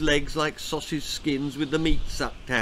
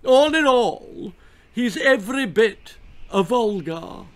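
gushing marquis; 9 semitones higher, 6 dB louder than usual. A narrator's reading. Marquis admiring the baby, gushing loudly. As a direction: gush over your own noble chin. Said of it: "gushed the Marquis of Boot".

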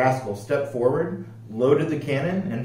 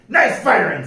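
pirate captain; 8 semitones higher, 7 dB louder than usual. A narrator's reading. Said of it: "said Pete".

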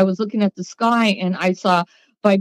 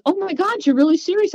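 surprised; 10 semitones higher than usual. Retold or imitated. Boyfriend shocked she's leaving.

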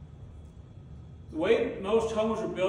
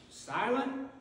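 doubtful question; 4 semitones higher than usual. Shown as a demonstration. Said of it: "Did you see that anywhere?".

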